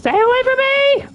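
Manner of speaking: high terrified yell; being chased. Real, not acted. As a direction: shriek to keep it away. Ghost chasing the player.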